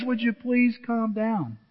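slow, patient calm-down; Jesus soothing frightened disciples, slowly. Retold or imitated. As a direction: settle the room gently. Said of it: "Again, he says".

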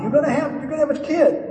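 high, loud mock amazement; hammed-up incredulous announcement; astonishing news. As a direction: pitch it up at the absurdity. Acting out astonishing news to Abraham.

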